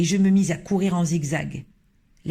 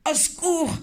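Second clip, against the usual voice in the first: much higher cry for help; 11 semitones higher than usual. Acted. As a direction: beg for rescue in mock panic. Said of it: "criait l'homme".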